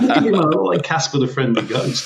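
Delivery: high, loud and laughing at himself. Real, not acted. Guest laughs about looking pale beside the dapper host, laughing loud.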